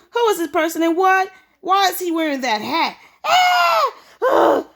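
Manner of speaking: high, loud panicked screaming; horrified shriek. Acted. Stepmother screaming at the stranger's hat, high and loud.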